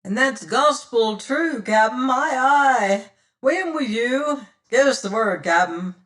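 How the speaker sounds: fierce pirate crew. Acted. Pirates answering their captain, fierce and loud. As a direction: answer like a crew spoiling for a fight. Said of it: "quoth divers voices in fierce answer".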